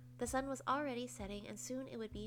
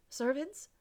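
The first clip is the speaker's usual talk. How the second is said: commanding; 4 semitones higher, 6 dB louder than usual. Acted. Queen commands servants.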